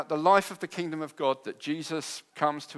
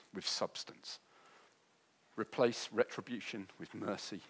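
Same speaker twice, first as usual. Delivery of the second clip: quiet closing; 8 semitones lower, 10 dB quieter than usual. Acted.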